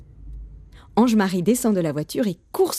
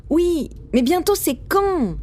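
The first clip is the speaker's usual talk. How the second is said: high, drawn-out whine of a question; insistent child; 10 semitones higher, slower than usual. A narrator's reading. Boy pushing papa for a real answer, insistent. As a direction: press dad, stretching the words high. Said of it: "insiste-t-il".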